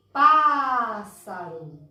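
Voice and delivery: "Pássaro" with a strong, very long Pá; stressed first syllable. Shown as a demonstration. Teacher shows the strongest sound, stressing "Pá" in "Pássaro". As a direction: push and stretch "Pá", let the rest of "Pássaro" drop. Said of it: "som mais forte é no pá".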